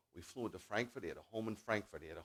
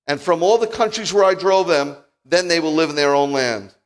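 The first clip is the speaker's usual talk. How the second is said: loud, high prophetic proclamation; preached scripture reading; 7 semitones higher, 14 dB louder than usual. Acted. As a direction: ring the promise out to the congregation. Reads prophecy to congregation.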